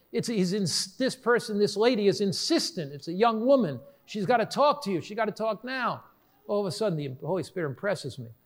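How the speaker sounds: normal talking voice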